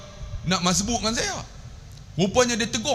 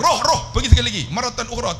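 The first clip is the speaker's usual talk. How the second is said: imitated sheikh's order; 6 semitones higher, faster than usual. Sheikh sending him back to redo ablution, imitated high urgent order. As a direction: send him off in a high, hurried voice. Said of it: "dia tahu".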